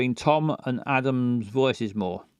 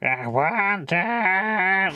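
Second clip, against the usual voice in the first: high, very slow drawl; [name]'s slow talk; 7 semitones higher, much slower than usual. Shown as a demonstration. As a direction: stretch each word way out. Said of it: "he's gonna talk slower".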